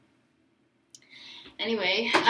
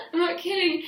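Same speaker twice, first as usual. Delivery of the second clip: laughing through it, a little higher; 7 semitones higher than usual. Real, not acted. Insisting to her audience the hug lasted minutes, laughing. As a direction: giggle the words out.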